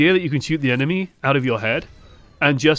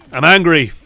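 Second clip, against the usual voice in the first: angrily repeating it louder; real anger, louder; 8 dB louder than usual. Real, not acted. Coach venting at his team's play, louder and angry. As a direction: let the anger build on the repeat.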